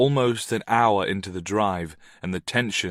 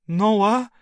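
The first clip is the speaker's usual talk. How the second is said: woman on the phone; 12 semitones higher, 7 dB louder than usual. A narrator's reading.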